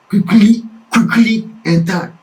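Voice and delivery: ordinary delivery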